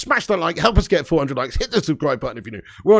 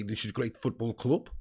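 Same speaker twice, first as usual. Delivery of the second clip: low, soft Dutch-accented line, club said as klub; general Dutch accent; 6 semitones lower, 10 dB quieter than usual. Shown as a demonstration. Showing the Dutch accent to chat, low and soft. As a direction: Dutch manager voice, club with a u vowel. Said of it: "just do a general Dutch accent and say".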